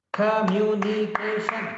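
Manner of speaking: "Communication" chopped into five even beats; syllable by syllable. Shown as a demonstration. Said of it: "Five syllables".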